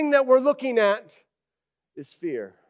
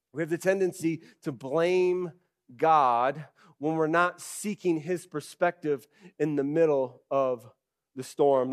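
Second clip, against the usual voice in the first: ordinary talk, nothing marked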